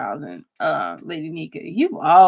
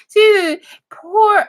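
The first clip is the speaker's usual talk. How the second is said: normal talking voice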